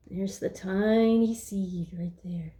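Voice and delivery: slow whispered aside. Acted. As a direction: whisper it slowly, as if sharing a secret with the kids. Reading to children, pointing at picture.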